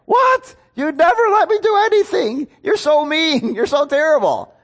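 high-pitched mock kid outburst; hammed-up, loud childish protest. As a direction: whine like an outraged child told no. Child's outburst when told no.

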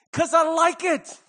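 high, slow and emphatic; emphatic delight. Real, not acted. Father explaining gifts to his kids, emphatically delighted.

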